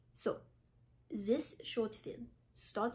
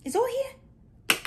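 dramatic exclaim; 12 semitones higher, 10 dB louder, much slower than usual. Acted. Dramatic reveal of bag.

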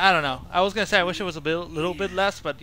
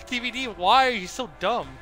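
laughing exasperation; 7 semitones higher than usual. Real, not acted.

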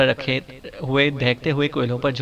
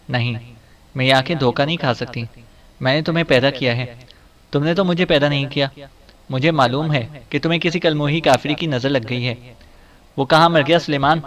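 ordinary delivery

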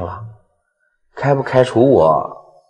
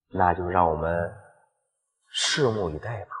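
movie villain; 3 semitones lower, 7 dB quieter than usual. Acted. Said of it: "villain gloats quietly".

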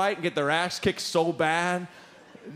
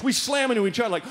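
ordinary delivery

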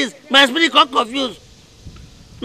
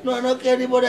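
ordinary talk, nothing marked

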